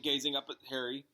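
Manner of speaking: usual speaking voice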